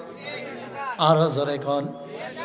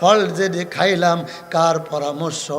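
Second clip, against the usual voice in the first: low, softer chanted question; reproachful question, intoned low; Adam calling Hawa; 8 semitones lower, 5 dB quieter than usual. Retold or imitated. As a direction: call to her in a subdued sing-song. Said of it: "হাওয়াকে ডাক দিয়ে কয়".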